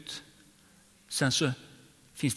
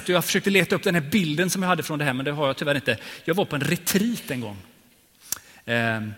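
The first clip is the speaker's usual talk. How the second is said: ordinary delivery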